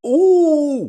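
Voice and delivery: high loud ooh; impressed by a clever comment; delighted ooh. Real, not acted. Streamer reacting to chat, a high loud ooh.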